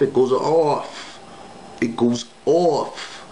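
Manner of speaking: slow, dragged out it goes off; drawn out. Shown as a demonstration. Describing the girl's contorted smile, slowly dragged out. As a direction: stretch each word slowly for effect. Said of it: "One more time".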